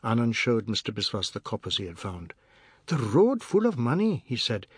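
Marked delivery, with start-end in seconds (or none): 2.9-4.2 put-on high-pitched child's voice; eager little boy. Anand shows his father the coins, high boyish voice. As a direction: report it in a high boy's voice.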